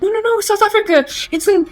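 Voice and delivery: high-pitched, insistent explaining; retold amused correction. Explaining to the confused American girl, high-pitched insistence. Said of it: "I could see her confusion and my laughter".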